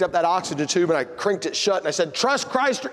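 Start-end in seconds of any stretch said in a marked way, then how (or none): 2.1-2.9 mock forceful. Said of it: "No, I'm just kidding".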